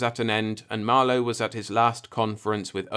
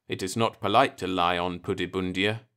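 plain reading voice

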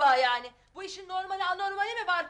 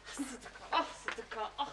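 out of breath; 5 semitones lower, 14 dB quieter than usual. Acted. Ağır adamı taşırken yorgunluk.

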